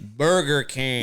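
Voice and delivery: "Burger King" in drawn-out American English. Shown as a demonstration. Co-host imitating the ad's insert, slow American accent.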